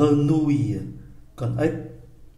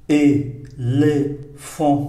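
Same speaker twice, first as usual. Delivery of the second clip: "éléphant" very slow, split in three; syllable by syllable; much slower than usual. Shown as a demonstration. Teacher introduces the word, "éléphant" slowly broken up. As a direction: sound out "éléphant" one syllable at a time.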